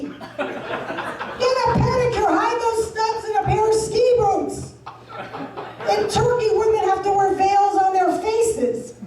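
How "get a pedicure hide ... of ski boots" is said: mock scolding. Scolding people with ugly feet.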